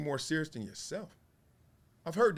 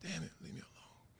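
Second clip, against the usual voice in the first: hushed complaint; 7 semitones lower, 19 dB quieter than usual. Retold or imitated. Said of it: "And I was like".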